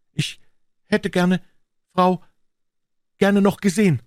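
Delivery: put-on a boy trying to sound like a man; young officer, choked up. A narrator's reading. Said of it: "sagte er dann endlich". (junger Offizier, den Tränen nah, viel höher)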